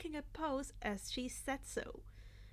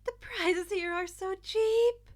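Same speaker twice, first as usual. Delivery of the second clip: put-on higher, louder, slow delighted girl; giggly; 7 semitones higher, 11 dB louder than usual. Uraraka delighted at cheap prices, higher and giggly. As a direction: giggle through it. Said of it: "Uraraka giggled".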